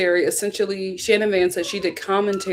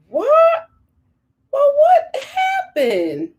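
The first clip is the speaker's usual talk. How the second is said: very high, stretched-out disbelief; high, incredulous gossip reaction; mock shock; much slower than usual. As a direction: squeal the question and draw it out. Reacting to the statement just read.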